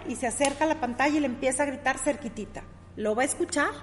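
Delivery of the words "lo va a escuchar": ordinary talk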